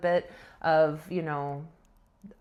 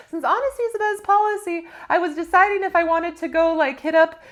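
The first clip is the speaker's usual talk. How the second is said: nervous confession; 9 semitones higher, 6 dB louder than usual. Retold or imitated. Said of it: "I'm nervous".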